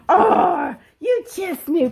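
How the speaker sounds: excited. Real, not acted. Dog caught her with camera.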